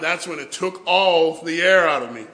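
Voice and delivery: ordinary delivery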